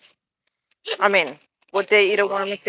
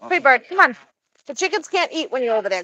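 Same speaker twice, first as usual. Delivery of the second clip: usual speaking voice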